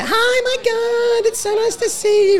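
put-on very high, slow squeal of a gushing girl; high-pitched teeny bopper. Publican mimicking young girls entering a pub, very high slow squeal. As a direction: squeal the greeting like a teenager walking into the pub. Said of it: "the high pitch".

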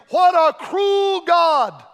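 long, drawn-out imitation of an outraged scream; retold scream. Preacher re-enacting a man's outburst in church, screaming. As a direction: yell it high and slow, as the heckler did. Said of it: "and he screamed".

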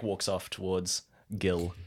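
normal talking voice

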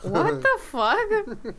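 high, slow disbelief; shocked. Real, not acted. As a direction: stretch it out in disbelief. Reacting to a cheesy line.

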